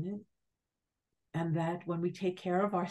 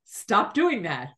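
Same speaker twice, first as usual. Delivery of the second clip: louder, slow firm command; voicing the trees telling me off; trees scolding; 3 semitones higher, 7 dB louder, slower than usual. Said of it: "they said".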